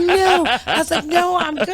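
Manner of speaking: mock whiny denial. Drunk daughter protesting to mom, high whiny denial.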